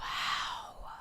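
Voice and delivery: hushed whisper of disbelief. Real, not acted. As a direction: breathe it out in a whisper. Stunned reaction to booing story.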